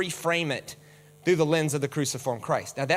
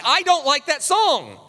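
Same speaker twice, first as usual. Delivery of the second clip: slower, higher, louder plain admission; blunt honesty; 8 semitones higher, 5 dB louder, slower than usual. Retold or imitated. Churchgoer admitting dislike of a song, slow and blunt. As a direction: say it straight, no excuses. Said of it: "just say what you mean".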